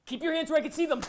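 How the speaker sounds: barked order; commanding shout. Acted. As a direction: yell the order. Soldier barks command.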